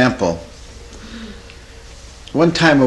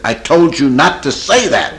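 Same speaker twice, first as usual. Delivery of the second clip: loud, higher hammed-up rebuke; mock angry scolding; 4 semitones higher, 6 dB louder than usual. Therapist getting on a client's case, mock angry scolding. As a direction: act out losing patience, loudly.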